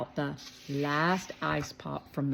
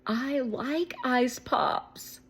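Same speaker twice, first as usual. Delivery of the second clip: put-on slow, high sweet girl; Audrey; 8 semitones higher, much slower than usual. A narrator's reading. Audrey liking ice pops, slow girl voice. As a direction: draw it out slowly.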